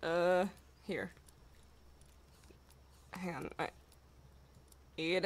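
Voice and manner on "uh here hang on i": ordinary talk, nothing marked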